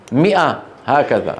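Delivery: normal talking voice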